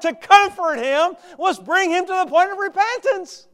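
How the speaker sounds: loud, high, driving preaching; preaching at full force. Acted. Preacher voicing Jonah's bitterness to the flock, full force. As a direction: hammer the congregation with it.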